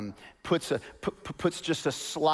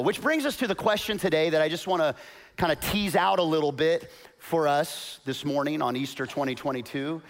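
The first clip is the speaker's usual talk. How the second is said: ordinary talk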